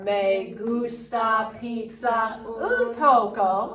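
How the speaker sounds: slow model phrase for repeating. Shown as a demonstration. Teacher modelling Spanish for the class, slowly. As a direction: stretch "me gusta pizza un poco", word by word. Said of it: "say it with me".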